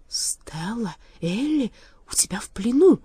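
hesitant, shocked, rising in disbelief; stunned, broken into halting pieces; stunned murmur. A narrator's reading. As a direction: begin hushed, end in astonishment. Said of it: "пробормотал поражённый до глубины души Страшила".

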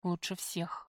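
soft, muted, sullen reply; dull, toneless. A narrator's reading. Prisoner answers the visitor's greeting, dull and hollow. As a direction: answer dully under the voice. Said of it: "глухо произнесла я".

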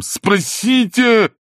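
very high, drawn-out loud cry; shout. A narrator's reading. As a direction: yell it out, stretching the word. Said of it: "и с криком". (протяжный высокий крик)

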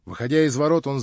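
plain reading voice

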